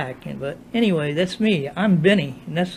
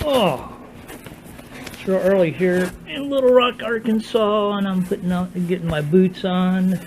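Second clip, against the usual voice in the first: talking without his teeth in; gummy speech, no teeth; no dentures in. Real, not acted. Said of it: "Still haven't put my teeth in".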